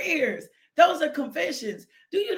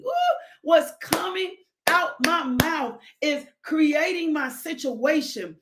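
ordinary talk

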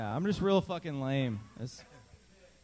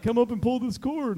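raised, higher voice of a tour guide; high tour guide call; 8 semitones higher, 5 dB louder than usual. Retold or imitated. Retold guide calling out.

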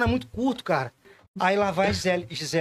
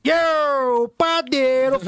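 baker's street call; 4 semitones higher, much slower than usual. Retold or imitated. Baker shouting at dawn.

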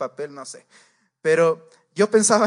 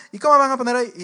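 louder, higher-pitched outraged question; mock indignant yell; 7 semitones higher, 8 dB louder, much faster than usual. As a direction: demand to know why, raising the voice. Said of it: "la señora más mala actitud". (pregunta indignada, más fuerte y aguda)

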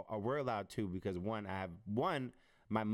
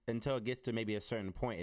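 normal talking voice

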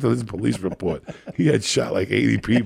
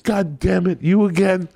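exasperated groan; 8 semitones higher than usual. Retold or imitated. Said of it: "and they would".

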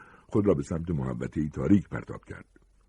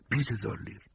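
hushed offer; 4 semitones higher, 6 dB quieter than usual. Acted. Fugitive offering a woman money for shelter, hushed offer. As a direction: offer it softly, like a secret.

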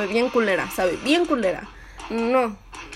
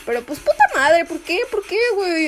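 frustrated outcry; 7 semitones higher than usual. Real, not acted. Frustrated cry of why.

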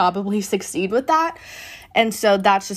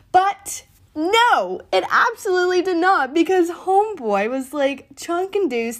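much higher, emphatic disbelief; emphatic exclaim; 9 semitones higher than usual. Real, not acted. Fan reacting to Brady leaving, high emphatic exclaim. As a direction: hit it high and insistent. Brady did not stay.

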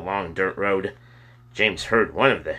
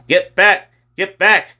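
high, loud shouted order; policemen yelling; 10 semitones higher, 7 dB louder than usual. A narrator's reading. Policemen to a crowd at a fire, yelling. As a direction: yell it out to push a crowd back. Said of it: "policemen yelling at people".

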